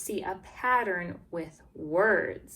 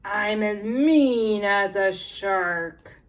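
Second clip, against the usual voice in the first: louder, slow, menacing read; mean; 9 dB louder, slower than usual. Shown as a demonstration. Teacher reading to kids, a mock-mean loud read. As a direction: snarl it like a shark.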